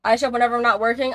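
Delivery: ordinary talk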